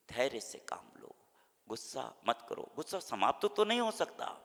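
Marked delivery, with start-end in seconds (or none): none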